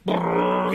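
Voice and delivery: low rolling car engine imitation; engine noise. Recapping a car chase scene, imitated engine. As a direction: trill a low engine rumble.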